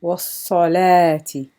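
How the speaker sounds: wassalati slow, with no waw after the l: was-sa-la-ti; careful wassalati. Shown as a demonstration. Teacher modelling wassalati slowly for students. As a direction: draw out wassalati without any w. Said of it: "just going to use the alif dagger".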